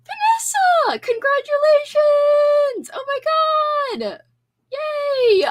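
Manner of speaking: high, loud drawn-out cheer of congratulations; excited cheer. Real, not acted. Streamer cheering Vanessa's big news, loud and thrilled. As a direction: shout it out with pure delight.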